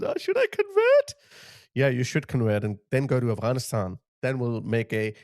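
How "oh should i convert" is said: mock weepy plea pitched way up; high, squeaky mock of a tearful woman; mocking whiny woman. Ridiculing a woman who cries over the call to prayer, high mock voice. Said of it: "She starts crying and says".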